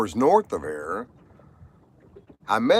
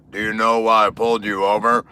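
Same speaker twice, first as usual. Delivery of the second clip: mock cop line; 3 dB louder than usual. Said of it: "that little".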